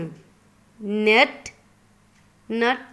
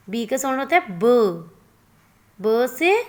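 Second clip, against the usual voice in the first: ordinary talk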